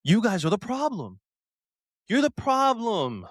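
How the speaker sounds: high-pitched, drawn-out emphatic accusation; exasperated accusation. Real, not acted. Fan blaming the ultras, exasperated. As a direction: throw the blame at them, pitch swooping up then falling.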